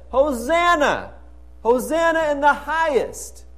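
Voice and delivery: high, loud hosanna; joyful shout. Retold or imitated. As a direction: let it ring out like the crowd. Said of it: "They did not restrain themselves".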